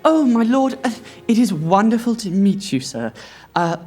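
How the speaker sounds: mother greeting a bachelor lord, high and gushing; fawning mother. Acted. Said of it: "she goes".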